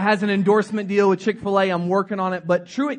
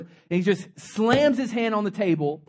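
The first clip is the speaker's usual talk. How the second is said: ordinary talk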